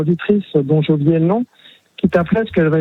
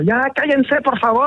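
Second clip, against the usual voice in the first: mock yell; 6 semitones higher than usual. Caller retelling his plea to noisy neighbours, mild mock yell. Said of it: "moi, après, je gueule".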